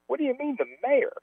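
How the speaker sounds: retold bafflement. Player questions the young guys, high baffled retelling. Acting out own bafflement in story.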